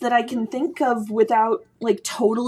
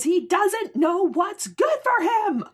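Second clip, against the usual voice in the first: high, slow exasperated cry; 11 semitones higher than usual. Real, not acted. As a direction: throw up your hands in disbelief. Exasperated exclamation about Higgins.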